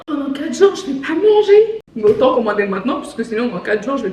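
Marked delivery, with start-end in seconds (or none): 0.1-1.8 gasping, playful dismay; mock dismay. Reacting to a four-day delivery wait, mock dismay. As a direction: voice climbs higher in pretend alarm.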